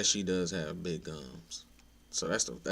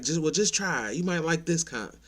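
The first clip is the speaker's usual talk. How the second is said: pushy insistence; 9 semitones higher, 5 dB louder than usual. Retold or imitated. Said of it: "And be like".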